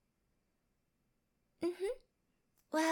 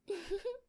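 higher, soft closed-mouth giggle; gloating giggle; 5 semitones higher, 4 dB quieter than usual. Acted. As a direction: giggle to yourself, pleased with it. Gloating over silenced captive.

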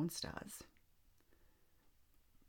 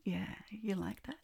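slow, hushed teasing whisper; 4 semitones higher than usual. Acted. Intimate whisper.

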